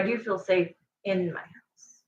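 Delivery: soft unvoiced whisper, confiding. Real, not acted. To her viewers about feeling safe at home, a whisper. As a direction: breathe it like a secret you're relieved to share.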